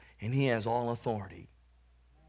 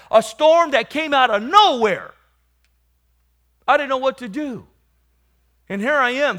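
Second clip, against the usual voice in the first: loud, high full-force preaching; 11 semitones higher, 12 dB louder than usual. Acted. Preacher telling the congregation about his storm, full-force preaching. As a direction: testify with raised, urgent force.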